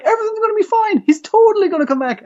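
high, chirpy fake reassurance; sing-song false comfort to a worried girl; sweet reassuring lie. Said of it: "it's like".

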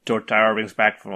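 ordinary talk

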